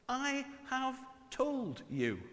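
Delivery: slow "I have told you" with told drawn out and falling; stress on told. Shown as a demonstration. Preacher repeating Jesus' words slowly for emphasis. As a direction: lean slowly on "told" and let it fall.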